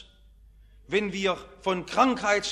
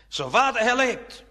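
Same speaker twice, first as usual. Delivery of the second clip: raised, higher prophetic proclamation; called out; 5 semitones higher, 3 dB louder than usual. Acted. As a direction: call it out firmly to a haughty king.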